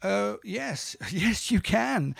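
retold happy agreement, stretched out; delighted yes. Songwriter answering the poet's request, delighted yes.

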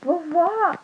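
very high, loud, slow alarm; rising fear at the big mouth; frightened little girl. Acted. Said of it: "ela falou".

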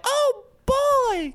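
put-on high, excited little kid; squeaky thrilled kid's exclamation. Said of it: "a little kid ran, comes up like".

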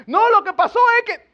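high, loud mock child; child making excuses. Child dodging a parent's question, high mock protest. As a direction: play an evasive kid whining out an excuse.

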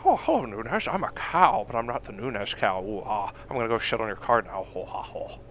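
lightly voiced goofy cow; silly cow voice. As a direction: ham up a cow that talks. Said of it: "put [name] in a cow suit".

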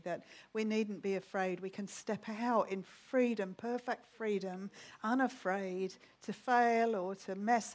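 soft, earnest closing prayer; quiet, heartfelt prayer. Real, not acted. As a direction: pray softly and sincerely to God.

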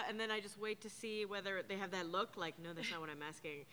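ordinary delivery

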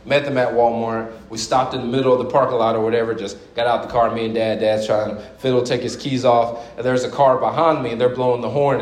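normal talking voice

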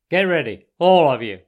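loud order to the troops; commanding. A narrator's reading. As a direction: brace the gang for the charge. Badger readies friends for attack.

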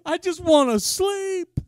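loud, tired plea to God; half-shouted weary complaint; exasperated groan. Retold or imitated. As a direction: groan it out, begging for sleep. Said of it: "and I'm like".

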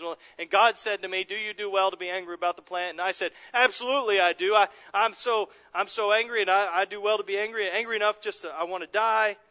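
loud, high, agitated retelling; angry Jonah. Acted. Jonah venting to his counselor, loud and agitated. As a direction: rant to your counselor, worked up. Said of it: "he gets to the point where he says".

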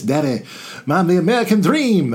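a hint of a bad Dusty Rhodes, claiming the title; Dusty Rhodes impression. Lecturer doing a bad Dusty Rhodes for the class, lightly voiced. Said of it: "a very horrible Dusty Rhodes impression".

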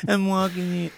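mock De Niro. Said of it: "De Niro does Wizard of Oz".

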